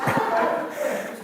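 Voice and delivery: airy giggle up high; soft airy laugh; high breathy laugh. Real, not acted. Laugh after punchline.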